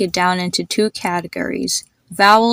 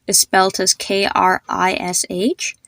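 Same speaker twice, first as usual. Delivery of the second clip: ordinary delivery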